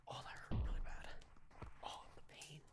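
hammed-up whisper of pain; mock pained whisper. Hurt from hitting himself, a hammed-up pained whisper. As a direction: whisper about the pain, overplayed.

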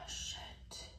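breathy whispered shit; whispered curse. Real, not acted. Whispered curse while working.

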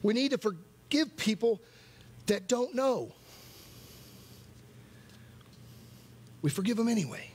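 high, urgent preaching; impassioned plea. Real, not acted. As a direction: plead with the congregation, pitch lifted. Preacher presses a hard truth.

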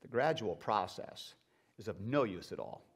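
stern angel. Acted. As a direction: speak as a grave, calm angel. Angel answers low.

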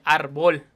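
"árbol" with the stress shifted onto the last syllable, like the rapper; moved stress. Shown as a demonstration. Explaining the rapper's rhyme to viewers, stress moved. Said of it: "si lo separas".

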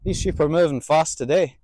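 usual speaking voice

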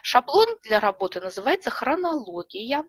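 ordinary talk

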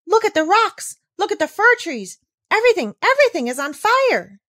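high, loud breathless exclamations; thrilled wonder. Acted. Girl shouting to Peter about the glowing mountains, thrilled. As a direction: gasp and point at the burning peaks. Heidi sees alpenglow, thrilled.

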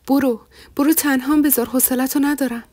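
loud, angry dismissal. A narrator's reading. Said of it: "با صدای بلندتری گفت". (با صدای بلند و عصبانی)

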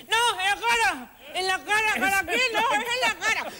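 high, slow pleading protest; retold protest, half-pleading; mock protest. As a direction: insist it's the face. Protesting to hospital staff.

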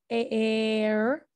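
"air" slowly drawn out from a long "ay"; long A sound. Shown as a demonstration. Teacher showing the long sound, "air" stretched out slowly. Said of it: "we have that long".

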